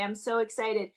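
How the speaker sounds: normal talking voice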